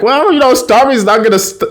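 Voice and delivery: high-pitched, offhand justification; retold defensive excuse. Sam excuses eating the tacos, defensive.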